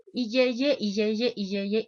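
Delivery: yeye fuerte que va bajando; burla del yeye. Shown as a demonstration. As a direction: repite yeye con tono burlón. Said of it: "repite la palabra de bebé". (yeye, yeye, yeye con burla)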